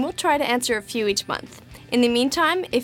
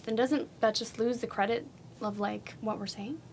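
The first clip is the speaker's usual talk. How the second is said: trailing off; 11 dB quieter than usual. Real, not acted. Voice fades out mid-question.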